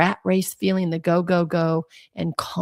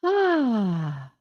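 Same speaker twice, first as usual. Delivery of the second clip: relieved sigh; 3 semitones higher than usual. Shown as a demonstration. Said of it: "everybody put your hand on your heart".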